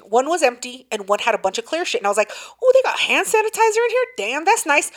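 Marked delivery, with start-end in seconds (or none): 2.6-4.9 high, quick mock-impressed reaction; hammed-up wonder at a lucky find; delighted discovery. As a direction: act pleasantly surprised, pitched up at first. Reliving delighted find of hand sanitizer.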